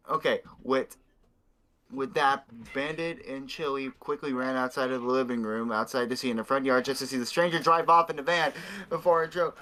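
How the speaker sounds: ordinary talk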